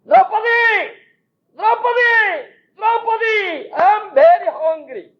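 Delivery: high calling shout of a hungry visitor; shouting name. Retold or imitated. Krishna calling Draupadi because he is hungry, high calling shout. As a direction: call out her name high, then demand food.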